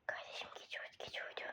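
unvoiced hushed whisper. Real, not acted. Sneaking player whispering to his brother, hushed whisper. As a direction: whisper so the sleeping man won't wake. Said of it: "Lan niye sessiz konuşuyorsun?".

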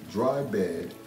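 ordinary delivery, nothing marked

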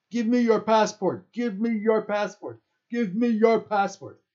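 high, loud repeated demand; livid shout. A narrator's reading. Livid security guard kicking the tent, angry shout. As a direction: shout it furiously, hammering each demand.

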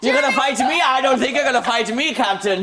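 hammed-up Irish accent. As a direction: ham up a leprechaun Irish brogue. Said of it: "You gotta say it in an Irish accent".

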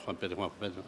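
very low, hushed mumble; under-the-breath repetition; muttered list-checking. Acted. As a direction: murmur the name to yourself while searching. Purga searches his list.

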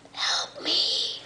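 breathy whispered plea. Acted. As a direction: whisper it like a captive. Plea for help in message.

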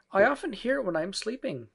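put-on high-pitched child's voice; little girl. A narrator's reading. Jane answers her mother, high little-girl voice.

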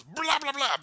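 loud, high babbled smashing sound; imitated crashing noise. Fan imitating Captain Marvel hitting the ship, crashing noise. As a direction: blurt out a comic crash effect.

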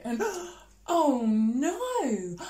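gasping, slowed, hammed-up oh no; big storybook gasp of alarm; mock shock. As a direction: play up the horror for the kids. Dramatic reveal of evil Griselda.

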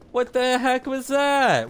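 high, slow, stunned yelp; startled outburst. Real, not acted. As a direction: caught off guard, voice jumps way up. Reacting in game to a surprise.

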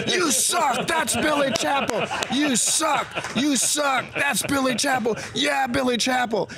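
high-pitched indignant crowd shout; crowd yelling. Retold or imitated. Three thousand fans turning on one heckler, high emphatic yelling. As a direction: scold the heckler as a whole crowd would.

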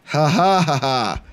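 slow mock laugh. Mocking the groundskeeper.

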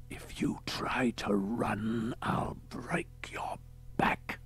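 slow, drawn-out threatening whisper; menacing whisper. Acted. Big cult leader leaning in to his captive, menacing whisper. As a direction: whisper it slowly, a threat for his ears only. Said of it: "leaned forward and whispered".

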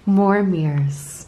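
drawn out punchline; slow punchline. Real, not acted. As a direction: stretch it out for the joke. Punchline after gasp.

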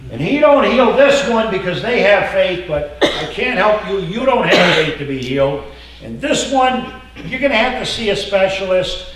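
loud mocking preaching; mock the picky healer loudly.

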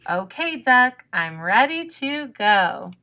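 loud, proud-sounding storybook announcement; announcing. A narrator's reading. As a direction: announce it with lifted voice. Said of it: "Raccoon announced".

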